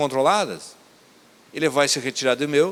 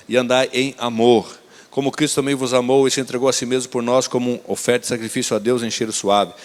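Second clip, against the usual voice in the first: louder, deliberate Bible reading voice; scripture reading; 2 semitones lower, 5 dB louder than usual. Acted. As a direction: proclaim the verses to the congregation. Reads Ephesians chapter five aloud.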